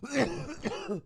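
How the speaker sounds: high, breathy burst of laughing; helpless laughter. Real, not acted. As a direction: crack up at your own story. Cracking up at own story.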